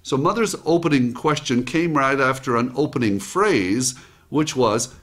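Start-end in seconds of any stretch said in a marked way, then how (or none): none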